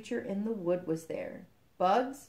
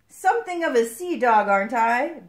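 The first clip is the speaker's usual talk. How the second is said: high, loud, jaunty boast; pleased with yourself, pitched up; playful boast; 5 semitones higher, 10 dB louder than usual. Acted. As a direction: show off like a proud little captain. Frog playfully boasting to the egg.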